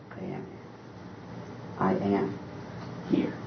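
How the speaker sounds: very soft, near whisper; hushed God voice. Acted. God answering troubled people in a soft hush. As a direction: barely breathe God's reply.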